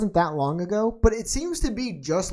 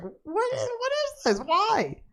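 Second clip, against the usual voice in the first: high, incredulous squeak of disbelief; much higher, baffled mock outrage; 4 dB quieter than usual. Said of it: "and I was like".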